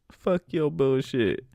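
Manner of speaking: normal talking voice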